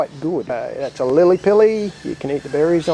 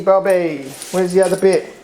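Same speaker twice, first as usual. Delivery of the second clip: dog training voice; 2 semitones higher, 5 dB louder, slower than usual. Real, not acted. Owner praising Bobby the dog through tricks, loud and bright.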